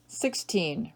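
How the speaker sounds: normal talking voice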